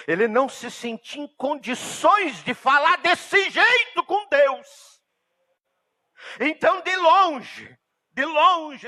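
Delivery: high, loud impassioned preacher; full-force preaching. Acted. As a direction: cry it out to the congregation. (pregação fervorosa, em voz alta e aguda)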